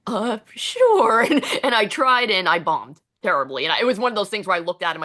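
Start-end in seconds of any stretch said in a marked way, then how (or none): 0.1-1.2 hesitant sure. Retold or imitated. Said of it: "I'm like".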